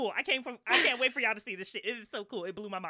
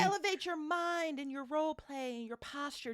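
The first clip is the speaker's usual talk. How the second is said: drawn-out, slow mock guru line; 5 semitones higher, slower than usual. Echoing the jokey call.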